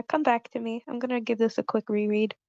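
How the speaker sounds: ordinary talk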